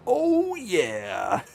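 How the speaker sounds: high, bursting "Oh, yeah" like the mascot; Kool-Aid Man. Retold or imitated. Streamer retelling relief at the traffic stop as Kool-Aid Man. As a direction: burst through the wall with it. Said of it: "Kool-Aid Man reference intended".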